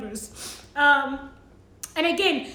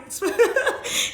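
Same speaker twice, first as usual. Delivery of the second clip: short teary giggle; real high laugh after tears; laughing through tears; 6 semitones higher than usual. Real, not acted. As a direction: laugh at her own crying. Tearful laugh at herself.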